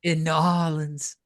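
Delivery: slow, put-on New Orleans accent; Louisiana accent. Co-host joining the accent bit, slow Louisiana drawl.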